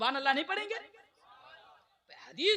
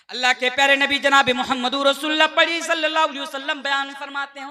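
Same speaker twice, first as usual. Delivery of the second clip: fervent sermon voice, rising in bursts; preaching at full force; 11 dB louder than usual. Acted. Preacher rousing the congregation about faith, fervent preaching. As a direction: preach it to the crowd with conviction.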